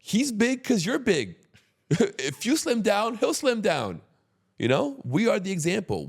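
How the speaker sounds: very high-pitched, emphatic pleading of the point; retold blunt lecture. Retells blunt lecture to friend.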